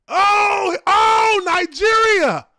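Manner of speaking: high, loud, drawn-out holler; excited shouting. Real, not acted. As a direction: shout it out, thrilled and stretched. Excited reaction to upcoming episode.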